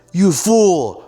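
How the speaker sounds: high shouted villain cry. Retold or imitated. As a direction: yell it like a wicked wizard. Villain line in the film.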